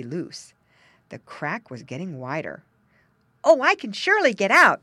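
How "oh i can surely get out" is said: high, loud excited little pig; a piglet sure of his escape; high loud piglet eagerness; eager piglet. A narrator's reading. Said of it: "he thought".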